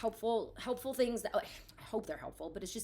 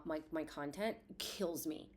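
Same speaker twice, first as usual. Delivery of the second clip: ordinary delivery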